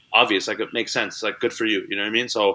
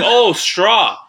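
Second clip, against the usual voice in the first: put-on higher, louder accented "Oh, straw"; mom's heavy accent; 9 semitones higher, 5 dB louder than usual. Mom clarifying her drive-thru order, accented and loud. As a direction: imitate accented mom, pitched up and emphatic. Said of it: "with the heaviest accent".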